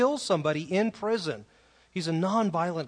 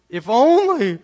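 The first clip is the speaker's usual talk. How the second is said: whiny voice; 7 semitones higher, 13 dB louder than usual. Shown as a demonstration. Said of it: "read this with a whiny voice".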